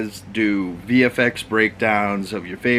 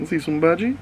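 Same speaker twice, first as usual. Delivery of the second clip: dog voice; 6 semitones higher, faster than usual. Pretend. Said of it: "Ain't that right, Bodhi?".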